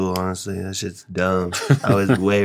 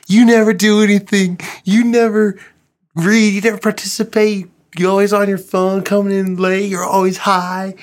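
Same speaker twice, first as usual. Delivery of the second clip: much higher, loud teacher's tirade; Mister Dane ripping into students; 11 semitones higher, 5 dB louder than usual. Retold or imitated. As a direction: imitate a teacher chewing out the class. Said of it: "getting ripped into by Mister Dane".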